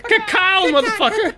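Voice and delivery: put-on high, loud bird voice with an accent; quail. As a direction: squawk it like a tough little bird, laughing. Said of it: "Have a little bird voice".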